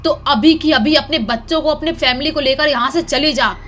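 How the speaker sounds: loud, menacing threat from the ghost women; ghostly shout. Acted. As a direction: scream a threat to scare her off. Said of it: "बहुत जोर से चिल्लाते हुए बोलती हैं". (भूतनी की डरावनी चीख़ती धमकी)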